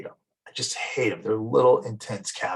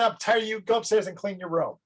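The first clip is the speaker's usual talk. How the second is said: put-on higher, exasperated parent snapping at a kid; mock angry parent; 9 semitones higher than usual. Fed-up parent ordering child to clean room, mock angry. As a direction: play the fed-up parent sending the kid upstairs.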